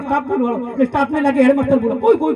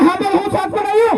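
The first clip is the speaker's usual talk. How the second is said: raised, higher voice for a deaf listener; calling into ear; 4 semitones higher, 4 dB louder than usual. Acted. Husband speaks into wife's ear, mock half-shout. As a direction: say it insistently, lightly acting a half-shout. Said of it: "એટલે કાનમાં કીધું".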